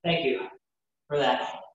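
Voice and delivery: ordinary delivery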